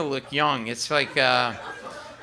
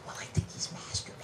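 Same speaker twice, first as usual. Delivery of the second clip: a quick hushed whisper; 13 dB quieter, faster than usual. Retold or imitated. Wife whispering so the son won't overhear, hushed whisper. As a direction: whisper it so the son can't hear. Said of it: "She whispered".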